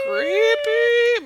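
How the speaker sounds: very high, drawn-out "creepy"; singsong spooky squeal, held long; mock-eerie. Playful spooky echo about the doll.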